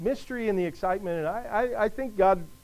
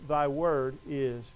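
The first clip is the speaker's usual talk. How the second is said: slow, low and softer; slow recitation; 6 semitones lower, 6 dB quieter, slower than usual. Real, not acted. Preacher reciting Jesus' prayer to the congregation, slow and measured. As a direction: recite it slowly and deliberately.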